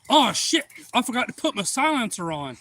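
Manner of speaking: high, loud mock oops; acted sudden realization. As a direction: imitate someone just remembering a mistake. Shooter realizes his mistake.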